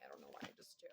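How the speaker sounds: breathy half-voiced whisper to herself; whispered aside. Real, not acted. Tarot reader whispering an aside to viewers. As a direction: drop into a secret whisper mid-sentence.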